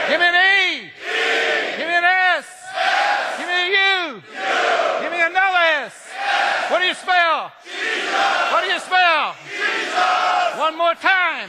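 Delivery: high, loud call and response cheer; cheer shout. Real, not acted. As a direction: lead the crowd like a cheerleader, full voice. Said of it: "have a little Jesus cheer".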